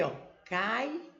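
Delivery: ordinary delivery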